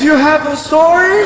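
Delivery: high, loud question in disbelief; mock a mom who can't believe it; incredulous mother. Acted mother questions her son.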